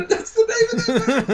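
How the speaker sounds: high, squeaky talk through laughter; laughing. Real, not acted. Fan explaining a film gag, laughing helplessly. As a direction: get it out while cracking up.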